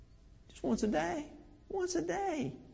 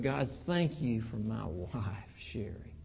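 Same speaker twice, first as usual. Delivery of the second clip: low, soft, slow earnest prayer to God; a husband's tender prayer for his wife; heartfelt prayer; 8 semitones lower, 5 dB quieter, slower than usual. Retold or imitated. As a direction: pray it humbly and quietly. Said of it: "I begin my prayer with".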